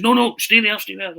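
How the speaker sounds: high, loud no no; anxious rush to stop him hanging up; frantic high stay there; urgent plea. Retold or imitated. Begging Larsson to wait.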